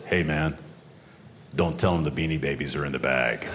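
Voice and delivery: low, sly devil on the shoulder; little devil voice. Retold or imitated. Tempting his conscience at customs, low sly devil. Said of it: "this little devil popped up on this shoulder and said".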